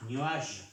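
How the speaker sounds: "Nuage" ending on zh, no E; "Nuage" as a teacher's model, final E dropped; silent final E. Shown as a demonstration. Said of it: "No se pronuncia la E".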